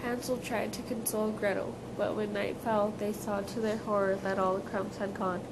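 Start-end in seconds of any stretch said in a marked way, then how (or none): none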